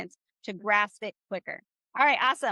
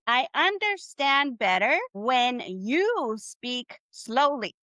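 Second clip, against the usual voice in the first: slow, spaced-out teaching delivery; half-speed, deliberate reading; slow phrase model; slower than usual. Shown as a demonstration. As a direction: lay out each word slowly for learners. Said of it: "this is what you're gonna say".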